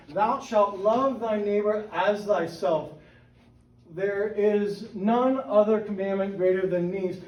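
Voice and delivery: slow, deliberate reading of the verse; emphasized verse. Shown as a demonstration. Reading the verse to the church, slow and deliberate. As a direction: lean on as thyself, word by word. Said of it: "you'll notice I emphasize".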